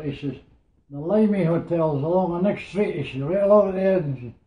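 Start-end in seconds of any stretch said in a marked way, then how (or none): 0.9-4.2 louder and higher, a retold barked order; sergeant shouting. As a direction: mimic the sergeant pushing him out, raised voice. Retold Canadian sergeant ordering him out.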